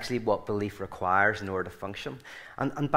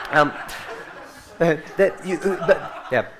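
laughing through words; 5 semitones higher, 3 dB louder than usual. Real, not acted. Still laughing after punchline.

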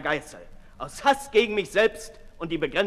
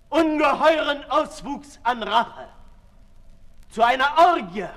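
rising fury; 10 semitones higher, 9 dB louder than usual. Acted. Sade tells Marat of his revenge fantasies, swelling loud fury. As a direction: let the hunger for revenge burst out.

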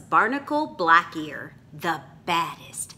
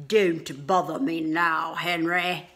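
brushing-off pirate. Acted. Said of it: "Calico Jack dismisses".

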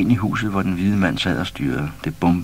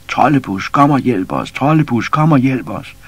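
loud, higher calls for help; calling out; 6 semitones higher, 6 dB louder than usual. A narrator's reading. Animals in the dream call out for help. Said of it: "råbte alle sammen".

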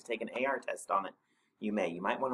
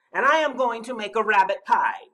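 high, loud monster menace; snarled threat; 12 semitones higher, 12 dB louder than usual. A narrator's reading. Snorklum telling Rabbit his pie plan, loud snarl. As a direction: snarl the menu at your prey. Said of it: "he snarled".

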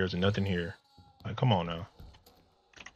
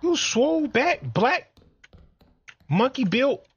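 excited taunting; 10 semitones higher than usual. Real, not acted. Insulting the monster in game.

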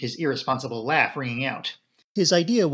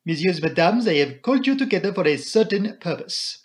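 put-on formal accented detective, pitched up; Poirot; 5 semitones higher, 4 dB louder than usual. Poirot opens the meeting, formal and accented. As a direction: address the room with ceremony, louder.

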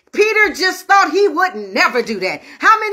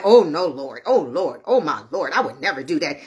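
low-pitched, imploring denial; low mock-solemn protest; protesting disciple; 12 semitones lower than usual. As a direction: voice a disciple earnestly swearing loyalty, well below usual pitch. Peter protests to Jesus in retelling.

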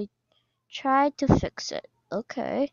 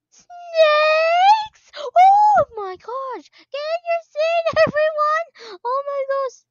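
high, loud, slow frightened cry; terrified squeal; 12 semitones higher, 4 dB louder, slower than usual. Real, not acted. Player screaming at snakes above the seats. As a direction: shriek on seeing snakes overhead.

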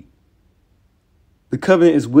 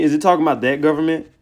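normal talking voice

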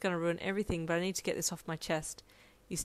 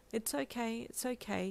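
soft, higher, tender reassurance; soothing; 4 semitones higher, 6 dB quieter than usual. A narrator's reading. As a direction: hush and comfort someone crying. Comforting her, patting head.

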